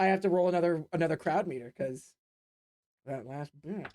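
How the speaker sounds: ordinary talk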